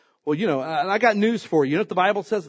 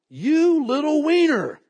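a hint of a sneering giant, drawn out; mock Goliath taunt; 10 semitones higher, 4 dB louder, slower than usual. Goliath sneering at young David, lightly mocking. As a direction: taunt the small boy, lightly hammed. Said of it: "that was my version".

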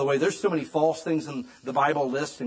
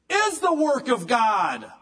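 proclaiming; 8 semitones higher, 5 dB louder, slower than usual. Acted. Preacher proclaiming Jesus' answer.

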